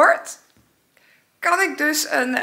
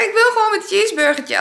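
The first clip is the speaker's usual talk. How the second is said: insisting; 11 semitones higher, 4 dB louder, faster than usual. Retold or imitated. Insists to the police.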